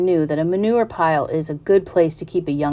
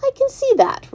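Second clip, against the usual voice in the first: much higher, thrilled pig voice; delighted pig. Acted. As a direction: squeal it in joy. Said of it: "replied Wilbur".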